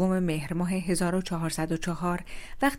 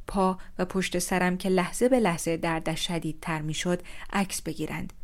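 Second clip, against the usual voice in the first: usual speaking voice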